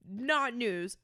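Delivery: normal talking voice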